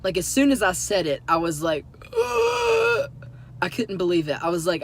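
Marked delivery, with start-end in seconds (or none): 2.2-3.1 high, loud strained wail of regret; horrified groan. Retold or imitated. Telling of the moment they named the wrong daylily, mortified wail. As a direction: re-enact a mortified squeal. Reliving instant regret at blunder.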